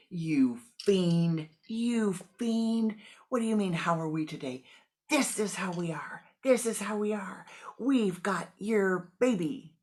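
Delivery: loud, hissing fury at the monk; furious accusation. Acted. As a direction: spit the insult at him. Parents confront the monk.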